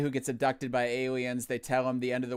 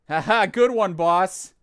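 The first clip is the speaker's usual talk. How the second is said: loud, higher mock laugh of a sucking-up henchman; fake henchman laugh; 7 semitones higher, 12 dB louder, slower than usual. Henchman laughs at his boss's joke in a loud mock laugh. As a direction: ham it up like a flattering henchman.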